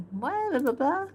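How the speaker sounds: high-pitched startled what, then babble; mock-shocked placeholder babble, jumping up high; incredulous mock outburst. Animator voices her character's reaction with nonsense, mock surprise.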